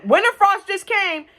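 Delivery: high slow emphatic aside; dramatic emphasis. Real, not acted. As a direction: lean hard on it for drama.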